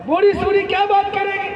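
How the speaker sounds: lightly acted wife, pitch lifted in scorn; scornful wife. Wife dismissing the old mother-in-law, scornful. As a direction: toss off a dismissive mock question.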